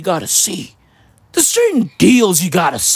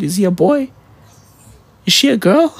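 usual speaking voice

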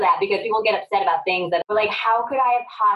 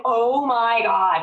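slow drawn-out "Oh my God" in mock panic; mock horror; 4 semitones higher, much slower than usual. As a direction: stretch it out, half-joking dismay. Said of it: "I would be like".

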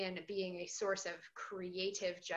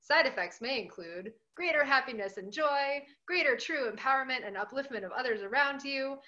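higher, louder TV ad announcer; drug commercial voice; 3 semitones higher, 5 dB louder than usual. Acted. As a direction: sell it like a pharma ad. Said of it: "This is sounding like a, a drug commercial".

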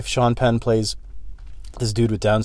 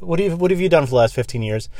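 ordinary talk

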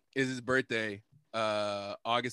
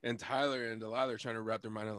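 ordinary talk, nothing marked

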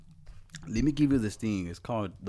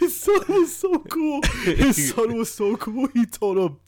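laughing; 13 semitones higher, 6 dB louder than usual. Real, not acted. High laughing repeat.

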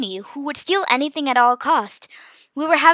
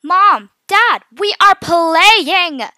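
high, slow, drawn-out yell; loud shout; 6 semitones higher, 9 dB louder, much slower than usual. A narrator's reading. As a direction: holler back at your parents, stretching each word. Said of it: "when Mia shouted, she did it loud".

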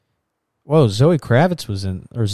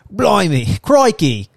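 higher, louder hammed-up Australian crikey; mock Australian exclaim; 8 semitones higher, 4 dB louder than usual. Joking about Mad Max's accent, higher, louder mock Australian cry. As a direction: shout it like a cartoon Aussie. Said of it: "that accent".

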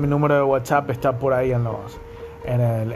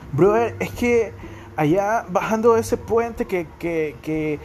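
imitating a snobby brother-talker, pitched higher throughout; posh rich kid; 4 semitones higher than usual. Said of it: "cuando decían".

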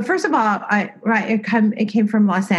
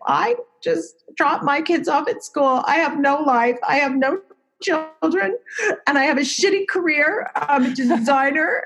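higher, half-crying retelling of her breakdown; retold tearful plea; 6 semitones higher than usual. Woman tells a stranger in a coffee shop why she is crying, half-sobbing and pitched high. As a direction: replay the teary coffee-shop confession, voice pitched up.

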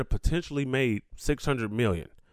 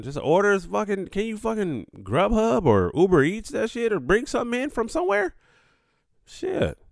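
ranting high and incredulous at pampered players; exasperated rant; 10 semitones higher than usual. Real, not acted. Vlogger rants at NBA players complaining about food, voice shooting up in disbelief.

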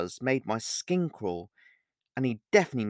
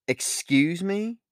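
slow, high, rising query; taken aback, pitch climbing; surprised; 6 semitones higher, 3 dB louder, much slower than usual. A narrator's reading. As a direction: draw it out in disbelief. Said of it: "an expression of surprise".

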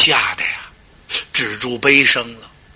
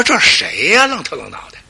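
scolding; 9 semitones higher than usual. Acted. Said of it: "心说".

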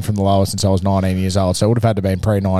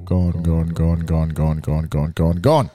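repeating echo, sinking lower; digital echo; 3 semitones lower, much slower than usual. Shown as a demonstration. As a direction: let the echo trail off low. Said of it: "Hear that echo?".

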